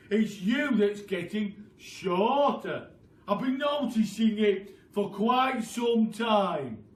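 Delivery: loud, slow gloating taunt; taunting Mr Twit. Acted. Husband taunting his wife that she is shrinking, loud and slow. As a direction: drag out every word to scare her.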